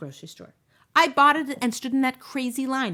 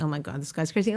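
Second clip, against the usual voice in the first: inner thought; 7 semitones lower, 7 dB quieter than usual. Retold or imitated. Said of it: "And you're thinking".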